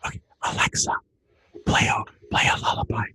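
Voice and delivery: soft whisper to the speaker. Shown as a demonstration. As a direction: whisper so the baby sleeps. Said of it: "the person's not whispering".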